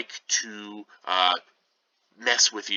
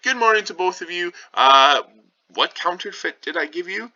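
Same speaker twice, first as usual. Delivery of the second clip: much higher, quicker, bright greeting voice; teacher playing a breezy Romeo, higher and quick; chipper Romeo; 10 semitones higher, 6 dB louder than usual. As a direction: pop up high like a cheery young man greeting friends. Teacher voicing Romeo's cheerful greeting.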